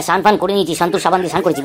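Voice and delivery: normal talking voice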